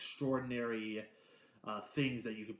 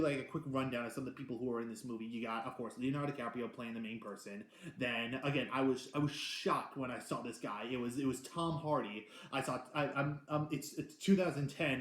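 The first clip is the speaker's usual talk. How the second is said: normal talking voice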